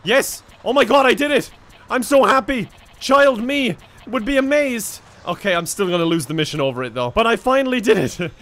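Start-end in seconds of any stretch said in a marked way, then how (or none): 0.0-5.0 high, louder cheer of triumph; real excitement. Real, not acted. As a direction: celebrate the win. Finally lands on the train.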